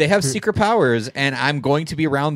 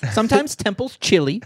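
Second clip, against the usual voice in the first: high-pitched motherly fussing; 9 semitones higher than usual. Retold or imitated.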